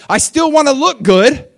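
high, loud whiny voice; mock vain Saul. Pastor mocking Saul's pride, high loud whine. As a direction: play Saul's vanity big. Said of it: "He's like".

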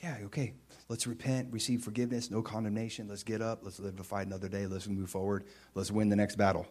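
gentle encouragement. Retold or imitated. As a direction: lift him up kindly and quietly. Pastor encouraging repentant disciple.

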